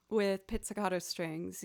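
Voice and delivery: ordinary talk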